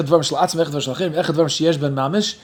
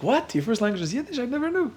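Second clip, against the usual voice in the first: high, soft mock astonishment; imitated surprise; 8 semitones higher, 6 dB quieter than usual. People reacting to his first language, imitated high-pitched surprise. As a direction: play an astonished listener, pitched way up.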